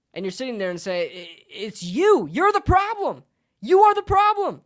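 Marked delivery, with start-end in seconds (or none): 1.1-4.6 shrill high yell, much louder, hammed-up; mock accusation. Pundit mocks the team, yelling back an accusation. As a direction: jab it at them like a verdict.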